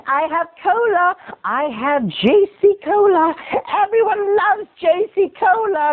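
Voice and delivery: put-on high, drawn-out elderly coaxing; old lady voice. Old neighbor offers boy cola, coaxing. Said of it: "That's my old voice".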